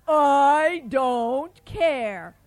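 loud, slow giant voice; loud defiant giant. Acted. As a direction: bellow it slowly like a giant.

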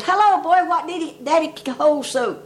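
mock holler from below. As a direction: holler up out of the bear den. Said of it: "Pat hollered up".

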